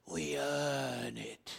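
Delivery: put-on slow, low, soft old English actor's accent; John Houseman's English accent. Pastor imitating an old commercial, slow English accent. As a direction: imitate a grave English spokesman, drawing out each word. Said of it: "he would say in that English accent".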